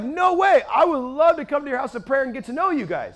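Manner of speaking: high, loud delighted disbelief; stoked. Retold or imitated. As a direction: burst out with eager, high-pitched enthusiasm. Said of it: "I'm so stoked".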